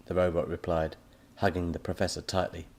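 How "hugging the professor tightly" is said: ordinary delivery, nothing marked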